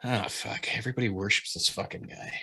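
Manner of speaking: fed-up student. Retold or imitated. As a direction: grumble like a bored student rolling his eyes. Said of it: "some people were like".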